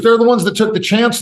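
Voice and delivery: put-on high, earnest child's reasoning; little boy arguing. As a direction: argue it like a stubborn kid. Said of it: "He goes".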